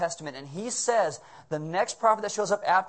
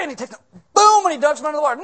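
excited shout; 10 semitones higher, 11 dB louder, much faster than usual. Acted. Narrating sergeant scene, excited.

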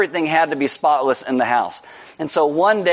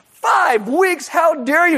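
indignant; 11 semitones higher, slower than usual. Retold or imitated. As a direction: yell in offended disbelief. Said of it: "And indignantly".

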